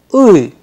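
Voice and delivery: loud, clear uy sound; Russian vowel uy. Shown as a demonstration. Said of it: "Let's repeat it once again".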